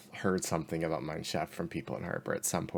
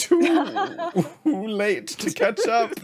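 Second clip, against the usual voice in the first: high, drawn-out mocking crow; gloating taunt; 12 semitones higher, 5 dB louder, slower than usual. Rival laughing from atop the passing van, gloating. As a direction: stretch it out, breaking into laughter. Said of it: "laughing at the top of his lungs".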